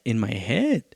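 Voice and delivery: ordinary talk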